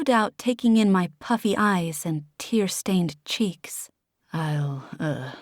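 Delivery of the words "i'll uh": lower, softer, hushed mutter. Acted. Guillermo excusing himself awkwardly, muttered low. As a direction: slip it out awkwardly under breath. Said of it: "he muttered".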